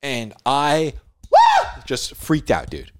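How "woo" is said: startled woo. Retold or imitated. Freaked out by the creature.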